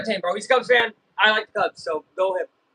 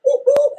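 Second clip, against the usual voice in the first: celebratory woo-hoo, pitched way up; high-pitched cheer; loud high whoop; 9 dB louder than usual. Real, not acted. Cheering during the game.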